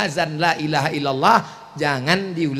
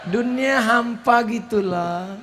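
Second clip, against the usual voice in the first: higher, softer coaxing plea; sweet-talking wife; 5 semitones higher, 5 dB quieter than usual. Retold or imitated. New wife sweet-talking her husband, mock tender plea. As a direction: coo like a clingy new wife. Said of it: "Sekarang kata-katanya lembut".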